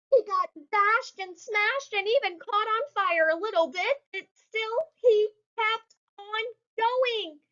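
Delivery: high, slowing storybook voice; high cartoon voice. Reading kids the race, narrated in high cartoon voice. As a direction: tell it in a squeaky truck voice.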